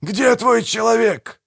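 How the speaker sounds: high, drawn-out angry yell; demanding, high-pitched shout; yelling in someone's face. A narrator's reading. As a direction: roar the question right at him. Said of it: "орать в лицо".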